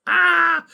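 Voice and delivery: short, very high, loud mock scream; mock panicked shriek. Politician facing jail for lying, a hammed-up shriek. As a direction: let out a hammed-up cry of panic.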